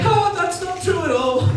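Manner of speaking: high, loud, drawn-out mocking cry; singsong exaggerated disbelief; mock dismissive laugh. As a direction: imitate a laugh that says no way. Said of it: "gave a laugh that was like".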